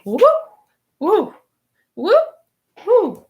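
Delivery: short, high whoops with gaps between; quick rising whoop, popped out loud; imitated whoops. Reader imitating the man leaving his chair, high playful whoops. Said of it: "so he's like".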